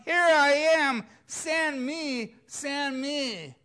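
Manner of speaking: loud, high cry of Here I am; eager answer. Pretend. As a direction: shout it like Isaiah volunteering. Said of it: "And we respond".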